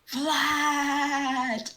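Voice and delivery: sharp accusing shout; loud. Pretend. Pony joke, shouted accusation.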